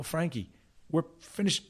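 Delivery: retold protest, pitched high; mild protest. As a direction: push back on a pestering teammate, re-enacted. Acted protest to insistent teammate.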